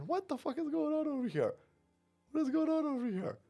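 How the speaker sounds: high falsetto take on baffled women; exaggerated high-pitched disbelief; mock bewilderment. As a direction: imitate stunned onlookers, voice way up high. Voices baffled ladies inside restaurant.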